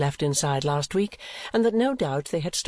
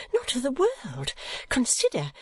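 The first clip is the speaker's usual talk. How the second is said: hasty protest; 12 semitones higher than usual. A narrator's reading. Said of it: "returned Kate hastily".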